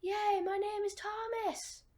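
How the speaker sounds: high, slow, drawn-out pretend shout; mock crowd cheer. The others celebrate his remembered name, mock shouted cheer. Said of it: "they all start shouting".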